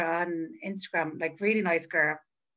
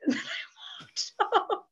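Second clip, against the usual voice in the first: high, slow, breathless giggle; laughing; 12 semitones higher, slower than usual. Real, not acted. Laughing at the awkward story, high and giggly. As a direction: laugh through the words.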